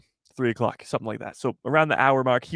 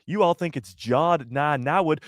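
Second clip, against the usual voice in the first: usual speaking voice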